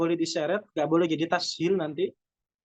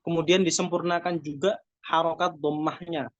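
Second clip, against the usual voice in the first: ordinary delivery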